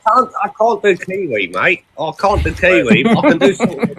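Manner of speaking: high-pitched attempt at New Zealand accent; mock Kiwi accent. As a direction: playfully fake a Kiwi twang.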